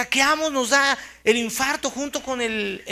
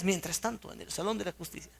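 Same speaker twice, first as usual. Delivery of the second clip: cartoon narrator; 5 semitones lower, 10 dB quieter, faster than usual. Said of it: "los que veíamos esas caricaturas".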